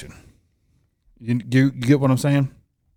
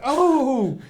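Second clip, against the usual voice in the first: high, exaggerated bewilderment; high-pitched acted protest; mock innocent reply; 9 semitones higher, 4 dB louder, slower than usual. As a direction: play up the innocent surprise. Imagined innocent reply to angry text.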